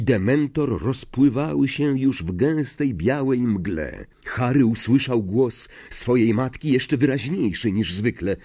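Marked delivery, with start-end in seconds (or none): none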